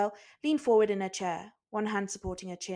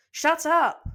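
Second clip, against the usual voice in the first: loud, high angry shout; 9 semitones higher, 10 dB louder than usual. Acted. Cook and swordsman shouting at Usopp together. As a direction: snap at him together. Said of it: "shouted in unison".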